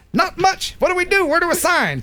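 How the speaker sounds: high, eager retold reply; eager buyer. Buyer answers the car salesman, comically eager and high.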